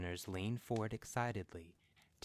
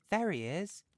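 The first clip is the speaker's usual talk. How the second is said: sudden high louder call; excited; 11 semitones higher, 7 dB louder than usual. A narrator's reading. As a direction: announce the arrival eagerly. Son announces arrival.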